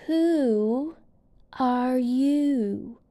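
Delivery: a lazy caterpillar puffing out a question; caterpillar asking. A narrator's reading. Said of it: "he asked".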